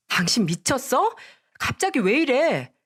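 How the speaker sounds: what the hell are you doing; shocked husband snapping at his wife. A narrator's reading.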